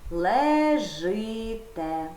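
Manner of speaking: Лежите stretched very slowly, each vowel held; teacher stretches Лежите for children to hear the vowel; drawn-out demo word. Shown as a demonstration. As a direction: draw out Лежите syllable by syllable so the ending vowel is clear. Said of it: "И чи ї?".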